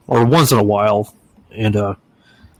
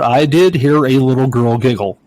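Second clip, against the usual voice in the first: usual speaking voice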